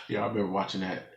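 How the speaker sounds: can barely get it out for laughing; laughing hard. Real, not acted.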